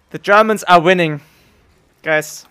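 loud, gleeful announcement to chat; excited loud call. Real, not acted. Streamer gleefully tells chat in a loud call. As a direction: call it out loud like a victory cry. Mocking chat about Germans winning.

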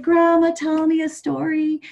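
wheedling little grandson; little chick voice. Acted. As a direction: beg like a small child at bedtime. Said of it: "Little ptarmigan said".

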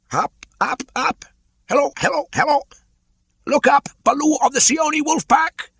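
very high, loud bird cry from above; a shrill-pitched kite shouting down to the bear; Chil the kite calling. Acted. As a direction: call down from the sky like a kite.

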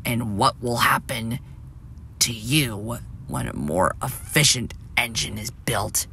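soft, unvoiced and cold; the old engine whispers a cold warning. A narrator's reading. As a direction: chill him with a hushed threat. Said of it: "She stared coldly at Splatter".

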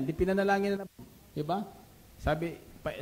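ordinary talk

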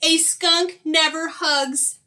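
high, loud, slow protest; emphatic storybook skunk outcry; indignant cry. A narrator's reading. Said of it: "cried Skunk".

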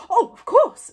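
high, loud burst of recognition; sudden recollection. A narrator's reading. Stoic remembering the professor's book, exclaiming. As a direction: exclaim as it comes back to you.

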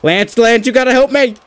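high, frantic cries; panicked. Real, not acted. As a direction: panic as the match slips away. Reversal, panicking, calls Lance.

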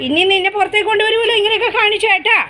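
hoger en luider, berispend tegen het dier; scolding the pet. Real, not acted. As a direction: wijs hem terecht.

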